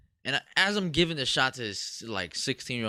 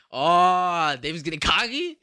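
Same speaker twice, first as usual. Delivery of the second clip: mock boss; 3 semitones higher, 5 dB louder, slower than usual. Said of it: "As my boss is listening, like".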